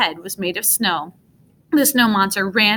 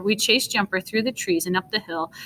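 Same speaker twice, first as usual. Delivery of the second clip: ordinary delivery, nothing marked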